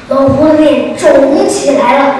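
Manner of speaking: usual speaking voice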